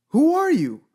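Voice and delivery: loud, high, indignant outburst; indignant challenge. Real, not acted. Vlogger challenging celebrities who told us how to vote, indignant. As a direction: throw it out high and loud, then drop.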